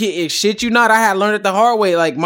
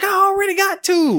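much higher exasperated squeak. Retold or imitated. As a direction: plead that two is already enough. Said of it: "I was like".

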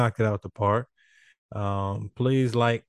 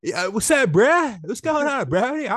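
much higher and louder hyped bro greeting; loud casual greeting; 12 semitones higher, 7 dB louder, slower than usual. Retold or imitated. Showing how he greets his friends, loud hyped bro voice. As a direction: call out to your boy, all hype. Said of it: "I be".

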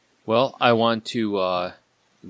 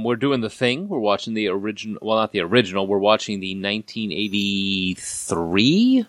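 ordinary talk

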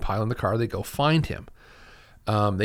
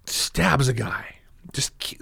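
whisper; slower than usual. Real, not acted. Whispered aside about the stabbing.

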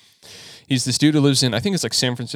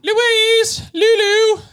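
very high, loud sing-out of a name; calling out; 8 dB louder, much slower than usual. Acted. Podcaster playing along as British mom, calling out high. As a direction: holler names in a piercing high voice.

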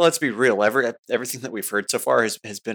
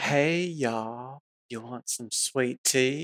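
high, soft mock Southern drawl; 5 semitones higher, 5 dB quieter, slower than usual. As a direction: put on a slow, sugary Southern accent. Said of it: "The very Southern drawl".

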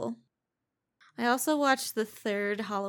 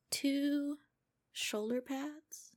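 dramatic build; 5 semitones higher, 8 dB louder, much slower than usual. Pretend.

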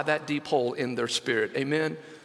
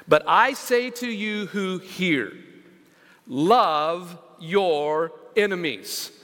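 scripture reading; 3 semitones higher, 3 dB louder, much slower than usual. Acted. Reads Jesus' words slowly.

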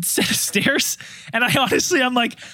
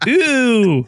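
drawn-out, louder revolted ooh; onlookers' disgust; 2 semitones higher, 4 dB louder than usual. Retold or imitated. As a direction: mimic appalled bystanders. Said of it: "People looked at me shitting in the bush and went".

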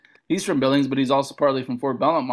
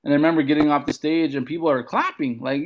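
ordinary delivery

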